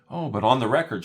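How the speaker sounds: ordinary talk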